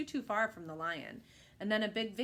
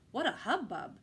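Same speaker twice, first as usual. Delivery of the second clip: ordinary delivery, nothing marked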